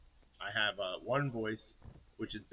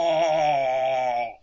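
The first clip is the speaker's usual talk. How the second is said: huge roaring yeah; wrestler yell; 5 semitones higher, 18 dB louder than usual. Pretend. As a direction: roar it to the crowd. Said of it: "if you think that voice would work".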